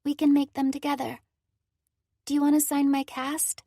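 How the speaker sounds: put-on young girl voice. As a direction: speak as a hurt little girl. Cora's girl voice.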